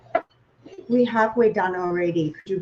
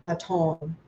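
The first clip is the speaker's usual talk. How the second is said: French accent; 4 semitones lower, 5 dB quieter than usual. Shown as a demonstration. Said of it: "That's my French".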